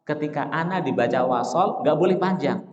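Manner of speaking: ordinary talk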